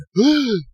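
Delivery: high startled gasp; sharp gasp. Retold or imitated. Nurse seeing the baby coming, high gasp. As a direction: gasp in shock.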